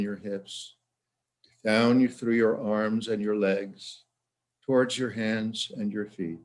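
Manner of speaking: unhurried instructions with long pauses between phrases; guided relaxation. Shown as a demonstration. Psychologist guiding listeners through warmth and heaviness, guided relaxation. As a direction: guide them gently from one body part to the next.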